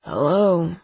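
groggy, just-woken phone answer; sleepy. A narrator's reading. Seth answers the phone, sleepy. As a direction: answer as if half asleep. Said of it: "says a sleepy voice".

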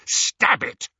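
high, loud harsh order. A narrator's reading. Harry orders Ron to strike the locket, harsh. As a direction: drive him to strike. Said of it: "Harry said harshly".

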